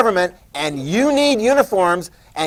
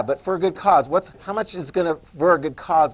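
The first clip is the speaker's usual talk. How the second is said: ordinary delivery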